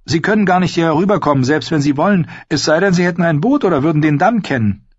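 high-voiced, quick boyish assurance; confident. A narrator's reading. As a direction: rattle off the reasons, sure of yourself. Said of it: "sagte er zuversichtlich".

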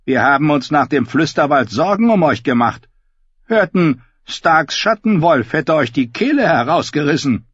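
high, loud bleating sheep voice. Acted. Davin voiced by narrator.

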